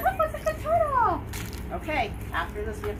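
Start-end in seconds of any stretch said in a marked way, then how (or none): 0.0-1.2 squeaky dog talk. Real, not acted. Coaxing the dog.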